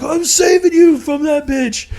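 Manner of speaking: put-on high, slow earnest drunk claim; hammed-up drunk heroics; drunk friend. Drunk friend insisting he is rescuing him, high and slow.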